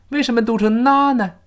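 ordinary talk

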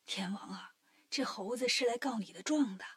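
hushed aside, breathy and soft; secret whisper. A narrator's reading. Venus whispers a warning to the Heavenly King. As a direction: whisper it so the monkey won't hear. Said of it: "悄悄说".

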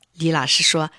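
plain reading voice

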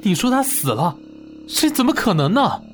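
high, rushed protest building to an outburst; agitated disbelief. Acted. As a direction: refuse to accept the news. Grandson refuses to believe friend died. (激动地不敢相信)

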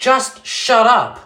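loud, high, drawn-out order; angry silencing. A narrator's reading. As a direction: shut them up, loud and slow. Boy snaps at a rude classmate.

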